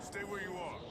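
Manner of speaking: high, soft call from a distance, carried on the storm; calling from afar. Acted. Krex calling to a frightened lost hiker from far off, high and distant. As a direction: shout after them gently so they stop running. Calling after a fleeing stranger.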